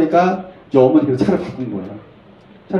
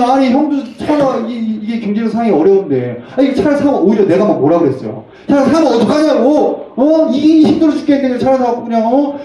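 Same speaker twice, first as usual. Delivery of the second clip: high-pitched, fretful telling-off; exasperated scolding; 7 semitones higher than usual. Acted. Pastor scolding struggling brother for buying the car, exasperated.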